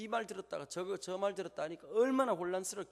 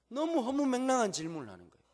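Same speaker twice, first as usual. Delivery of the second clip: indignant; 8 semitones higher, 6 dB louder than usual. Real, not acted. Indignant about absurd question.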